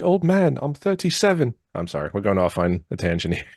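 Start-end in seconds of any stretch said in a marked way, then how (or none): 0.0-1.5 higher indignant peasant voice; Monty Python quote. Retold or imitated. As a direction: protest like an offended peasant. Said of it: "You said".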